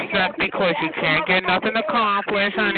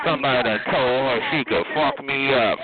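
usual speaking voice

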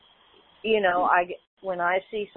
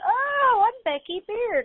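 neighbor lady; 3 dB louder, slower than usual. Retold or imitated. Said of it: "And she goes".

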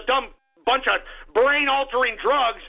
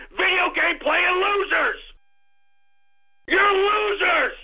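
angry shouting. Real, not acted. Host telling unwanted listeners to stop listening, angry shouting. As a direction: yell it to drive them off.

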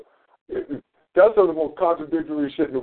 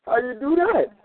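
pitched much higher, insistent and incredulous; retold heated objection; 8 semitones higher than usual. Tyler arguing you can't save the devil, mock-heated and much higher. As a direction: imitate Tyler's pushback, voice climbing high in disbelief. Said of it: "he said".

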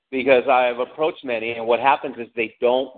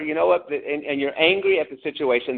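normal talking voice

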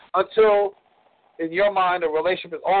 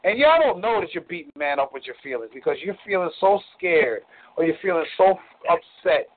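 usual speaking voice

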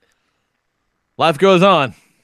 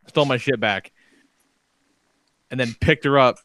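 usual speaking voice

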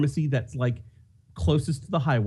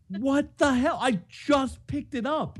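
high, incredulous outburst, slowing on the second line; mock outrage at yet another call; exasperated disbelief; 10 semitones higher, slower than usual. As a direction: pitch jumps up in annoyed disbelief. Another call right after pickup.

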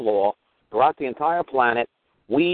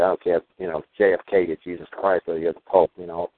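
dad's advice; 7 semitones lower than usual. Retold or imitated. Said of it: "my dad told me".

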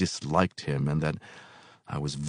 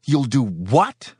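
raised, slow, incredulous question; stunned stammer; 8 semitones higher, 6 dB louder, slower than usual. A narrator's reading. Helper hearing a death threat, stunned stammer. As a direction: draw it out in disbelief. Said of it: "I stammered".

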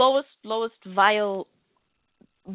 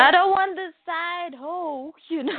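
sassy mock voice; 8 semitones higher than usual. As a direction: ham it up, sassy. Playful mock declaration.

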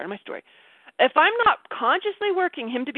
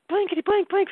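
high-pitched imitation of furious cursing; mock furious; 8 semitones higher than usual. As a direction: mimic an angry rant with placeholder swear words. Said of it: "He's so furious. He's like".

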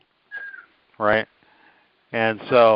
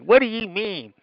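mock girl voice; 10 semitones higher than usual. Acted girl in commercial.

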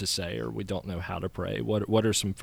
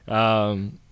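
ordinary talk, nothing marked

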